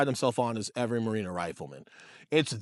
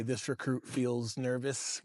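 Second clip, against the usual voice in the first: third person recruit; 8 dB quieter, much slower than usual. Retold or imitated. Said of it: "You have to say".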